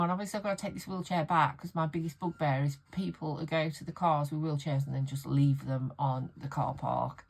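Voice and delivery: ordinary delivery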